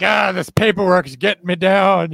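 mock griping. Host teasing a guest about selling out to admin, mock griping. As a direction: imitate a fed-up admin complaining.

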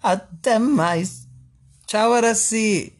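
high, slow TV host farewell; over eager infomercial. Pretend. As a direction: wave off the viewers like a presenter. Host signs off the segment.

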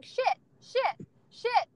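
high-pitched toddler imitation; little kid. Toddler repeating his new word down the store aisle, imitated high chirp. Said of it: "and he's just going".

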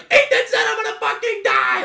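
high, wailing mock sob; imitated scream crying. Little boy running to mom, hammed-up crying wail. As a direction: wail it like a terrified little kid. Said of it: "I was scream crying".

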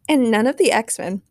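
usual speaking voice

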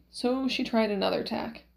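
plain reading voice

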